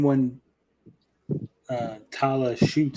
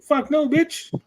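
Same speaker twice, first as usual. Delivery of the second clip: much higher, squeaky mock retort; shrieked comic brush-off; mock crude Obi-Wan. Mocking Obi-Wan rejecting Leia, squeaky high put-down. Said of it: "He's like".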